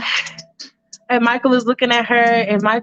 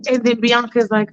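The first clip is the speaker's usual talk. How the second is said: normal talking voice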